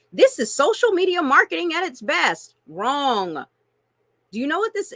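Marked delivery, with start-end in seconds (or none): 0.1-2.6 high, louder mocking praise; tongue-in-cheek hype; sarcastic mock praise. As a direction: sneer it like a sales guru. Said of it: "we are told".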